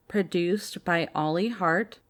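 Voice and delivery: usual speaking voice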